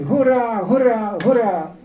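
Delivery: high, loud crowd cheer; crowd shouting. Retold or imitated. Crowds on the square shouting, high loud cheer. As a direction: shout hurá like a jubilant mass. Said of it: "A křičeli".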